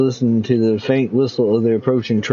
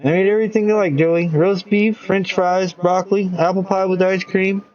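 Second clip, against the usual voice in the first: higher, fond mother's voice; higher doting mom; 6 semitones higher than usual. Acted. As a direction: list his favorites with delight.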